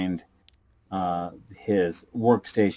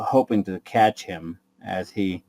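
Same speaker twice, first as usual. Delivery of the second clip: usual speaking voice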